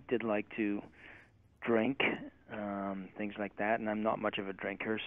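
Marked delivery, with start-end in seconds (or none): none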